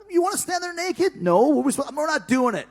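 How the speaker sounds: high, loud, panicky protest; panicked refusal. Retold or imitated. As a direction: voice climbing in alarm at the idea.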